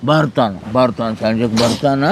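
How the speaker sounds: very low, soothing tone; reassuring. Acted. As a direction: comfort the child in a dropped voice. Reassuring child about absent father.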